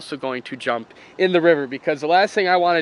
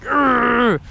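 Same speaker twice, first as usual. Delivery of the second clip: exasperated groan, voice pitched up; frustrated groan; 6 semitones higher, slower than usual. Retold or imitated. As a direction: groan at the fish that got away. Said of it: "It's like".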